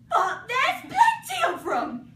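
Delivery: loud, high, slow protest; Alice objecting to the hosts, indignant. Acted. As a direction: huff it back at them. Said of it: "said Alice indignantly".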